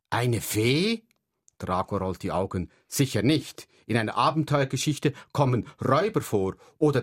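put-on lively read-aloud children's story voice; story narration. A narrator's reading. Author reading his book aloud to children, lively narration. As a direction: tell it brightly to young listeners, voicing each kid.

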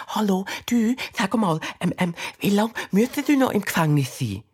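put-on bright little girl voice; curious girl. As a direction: call in friendly and nosy. Ella calls through the flap.

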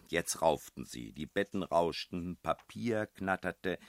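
ordinary delivery, nothing marked

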